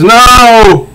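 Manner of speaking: high, loud anguished cry; anguished Riddler. Acted. Riddler despairing at his defeat, high and loud. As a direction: wail at the unfairness. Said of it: "he says".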